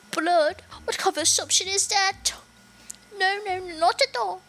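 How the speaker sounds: put-on high, protesting French accent. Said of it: "she's supposed to be French".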